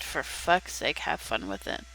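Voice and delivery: low, quiet exasperated grumble; exasperated mutter. Real, not acted. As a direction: mutter it under your breath, fed up. Annoyed at something on screen.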